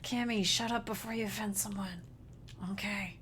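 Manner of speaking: soft aside to herself; quiet mock self-reproach; muttered self-scolding. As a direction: drop the volume and chide yourself. Quietly scolds herself after accent.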